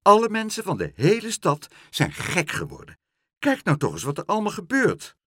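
high, loud complaint; calling out. A narrator's reading. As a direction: exclaim in indignation. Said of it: "riep mevrouw Helderder".

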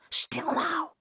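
high-pitched urgent shushing; urgent hushing. A narrator's reading. Girl hushing her brother to listen, urgent hush.

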